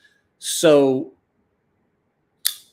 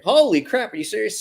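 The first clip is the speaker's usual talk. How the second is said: shocked disbelief; 9 semitones higher than usual. Retold or imitated. Told he can keep the knives, he answers in high stunned delight.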